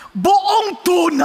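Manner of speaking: loud, slow amazed cry; drawn-out, astonished shout; amazed exclaim. Retold or imitated. Amazed at the whole tuna.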